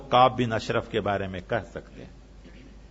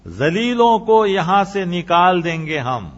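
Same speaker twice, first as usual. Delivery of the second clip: slow, louder arrogant vow; a hint of a sneering chief; boastful threat quoted; 5 semitones higher, 6 dB louder than usual. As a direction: voice the hypocrite's haughty threat. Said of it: "اس نے کہا".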